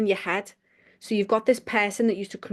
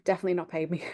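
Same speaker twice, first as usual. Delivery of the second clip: ordinary talk, nothing marked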